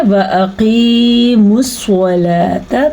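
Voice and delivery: slow tajweed chant with drawn-out long vowels; Quran recitation. Shown as a demonstration. Teacher modelling the verse for students, slow tajweed recitation. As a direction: recite word by word, holding each madd.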